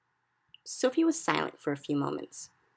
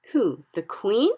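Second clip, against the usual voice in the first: slow, shocked question; aghast; 5 semitones higher, 9 dB louder, slower than usual. A narrator's reading. Sophie aghast at the giant's plan, shocked. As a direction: gasp it out in horror. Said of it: "Sophie cried aghast".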